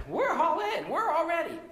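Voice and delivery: high, loud, keen assurance; eager brothers. Retold or imitated. Brothers insisting to Jesus they are ready, loud and eager. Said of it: "they said".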